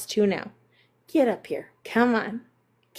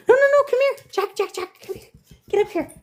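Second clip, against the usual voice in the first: calling the dog; 11 semitones higher than usual. Real, not acted.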